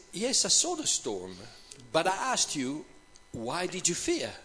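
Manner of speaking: soft, measured acted answer; calm reply. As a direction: answer an outburst quietly, letting pitch settle. Jesus calmly answers Peter's shout.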